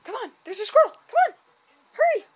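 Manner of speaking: high, loud urgent call; excited calling. Real, not acted. Calling a sibling to come see the squirrel, excited high shout. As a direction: yell for them to hurry over, thrilled.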